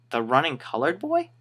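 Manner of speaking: rising, baffled question; incredulous echo. Retold or imitated. Employee repeating what the radio said, incredulous. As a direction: repeat it back in disbelief. Retold disbelief at radio message.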